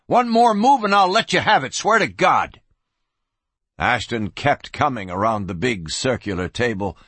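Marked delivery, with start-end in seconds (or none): 0.0-2.6 higher-pitched, forceful threat; warning. A narrator's reading. Gun kid threatening the advancing marshal, heated warning. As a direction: threaten him to stop him moving. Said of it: "he warned".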